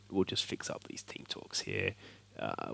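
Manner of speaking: whispered aside. Real, not acted. Aside while editing team talks.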